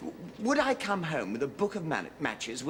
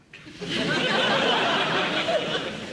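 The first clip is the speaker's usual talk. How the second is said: high, loud awkward laugh; embarrassed laugh; 8 dB louder than usual. Acted. Father corrected by the headmaster on the phone, awkward laugh.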